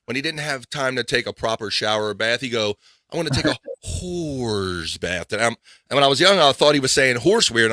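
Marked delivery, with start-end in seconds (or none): 3.1-5.3 drawn-out dad voice. Retold or imitated. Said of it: "my father always drew out that word".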